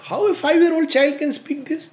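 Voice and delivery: high, loud astonished question; real amazement. Real, not acted. Parent marvelling at a five-year-old's words, amazed. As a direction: exclaim in sheer amazement.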